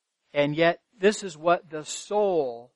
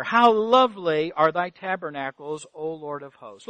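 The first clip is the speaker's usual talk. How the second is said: ordinary delivery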